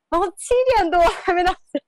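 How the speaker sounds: laughing through the words, pitched high. Real, not acted. Wife recounting waiting for her late husband, laughing. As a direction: laugh while telling of the long wait.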